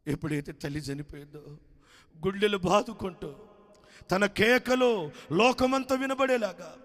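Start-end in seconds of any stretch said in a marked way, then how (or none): 2.3-6.8 high, loud anguished wail; wailing cry. A narrator's reading. Son wailing as his mother dies, a high, loud anguished cry. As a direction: shriek out the grief. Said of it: "ఆర్తనాదాలు చేస్తూ".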